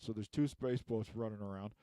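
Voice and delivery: ordinary talk, nothing marked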